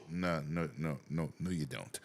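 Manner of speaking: low, soft repeated denial; acted patience, low and quiet; calm contradiction. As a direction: gently talk down a hysterical friend. Acting his calm reply to screamer.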